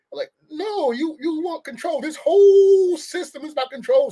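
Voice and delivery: you can't be serious, push back; exasperated objection. Real, not acted. Indignant rebuttal of the film.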